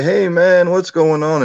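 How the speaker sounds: high, loud Southern drawl greeting; friendly Southern drawl hello. Retold or imitated. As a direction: imitate a buddy's Southern drawl. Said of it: "in that southern drawl that he has".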